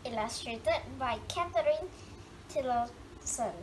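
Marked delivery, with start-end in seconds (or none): none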